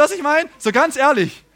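normal talking voice

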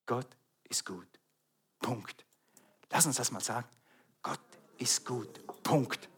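quiet, low and firm; soft declaration. Real, not acted. Preacher leading the church in a soft low declaration. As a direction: say it softly together. Congregation says it together softly.

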